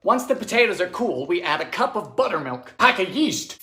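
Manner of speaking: ordinary talk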